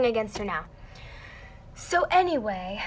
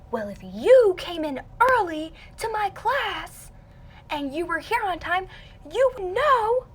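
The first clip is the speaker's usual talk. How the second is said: higher-pitched mock telling-off; retold teacher's reprimand, pitched up; imitated scolding teacher; 8 semitones higher than usual. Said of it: "she's screaming at me".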